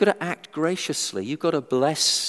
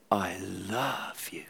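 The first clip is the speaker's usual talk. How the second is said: "I love you" whispered slow and soft; tender whisper; 8 semitones lower, 10 dB quieter, much slower than usual. Shown as a demonstration. Preacher shows the same words said lovingly, in a slow soft whisper. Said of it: "It's the way you say it".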